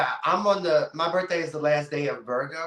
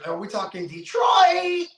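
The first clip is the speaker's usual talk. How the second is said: eager guess; 10 semitones higher than usual. Real, not acted. Guessing her hometown eagerly.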